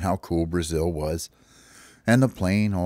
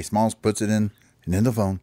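usual speaking voice

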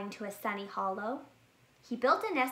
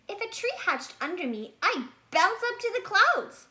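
much higher, rising up, louder; excited bear cub; 10 semitones higher, 6 dB louder than usual. Acted. Bear cub imagines a tree lifting him to the clouds, excited. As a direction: bounce up with delight.

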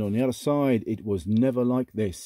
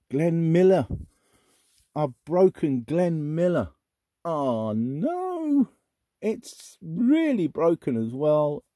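dismayed; 7 semitones higher, 3 dB louder, slower than usual. Real, not acted. Breaking a favourite record.

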